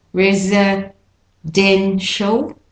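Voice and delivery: residential said slowly, syllable by syllable, DEN stressed; stress pattern shown. Shown as a demonstration. Teacher showing the stress pattern, residential slow and split. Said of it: "for the stress pattern".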